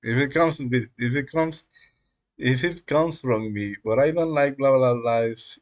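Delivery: normal talking voice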